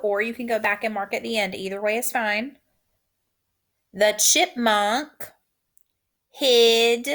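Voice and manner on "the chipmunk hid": slow dictation. Shown as a demonstration. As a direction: drag it out word by word, punch "hid". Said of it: "Repeat after me".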